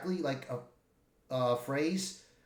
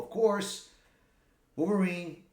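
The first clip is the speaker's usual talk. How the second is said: ordinary talk, nothing marked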